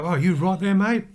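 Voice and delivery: light, friendly Australian "you right there, mate?" with easy rise; a shop assistant's relaxed Australian offer of help; casual Aussie check-in. Retold or imitated. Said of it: "someone has an accent, go".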